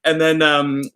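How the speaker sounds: ordinary delivery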